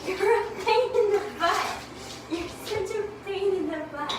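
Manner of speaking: high, teasing scold; playful scolding. Real, not acted. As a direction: scold the dog fondly.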